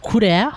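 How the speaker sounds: "Kure" in two syllables, second lifted; Japanese-style pronunciation. Shown as a demonstration. Streamer showing viewers how her name is said, Japanese-style pronunciation. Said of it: "her name is, like".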